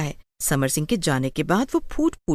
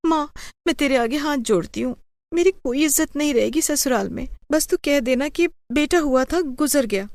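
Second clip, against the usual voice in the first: higher, begging mother to lie for her; desperate pleading; 8 semitones higher than usual. Acted. Daughter begs her mother, desperate high plea. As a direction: clasp your hands and plead with her.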